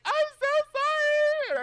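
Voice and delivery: loud, slow, very high wail; hammed-up tearful apology; falling apart. Player apologising to beaten boy, exaggerated wailing. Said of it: "And I just fell apart".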